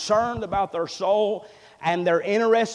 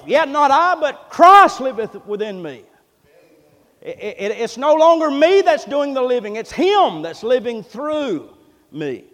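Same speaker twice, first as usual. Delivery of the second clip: loud, high, ringing scripture proclamation; full-force preaching; 6 semitones higher, 9 dB louder than usual. Real, not acted. Preacher declares Galatians verse to congregation, full force. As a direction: proclaim the verse, punching the key words.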